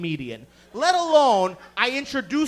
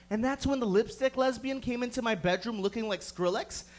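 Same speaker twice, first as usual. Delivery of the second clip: ordinary delivery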